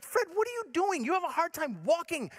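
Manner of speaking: high-pitched fretting at someone; mock alarmed disbelief. Storyteller watching old Fred climb the fence, high mock alarm. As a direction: question him in high mock dismay.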